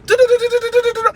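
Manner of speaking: high, loud rapid-fire "do-do-do"; imitated running water. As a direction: rattle it off high like gushing water. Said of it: "When I say it was do".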